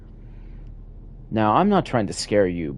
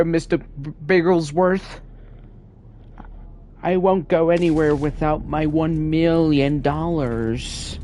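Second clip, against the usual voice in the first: Doctor Evil; 8 semitones higher than usual. Retold or imitated. Gamer riffing on a bank file, doing a high hammed-up villain. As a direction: squeal it like a cartoon villain gloating. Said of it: "Gee whiz, Doctor Evil".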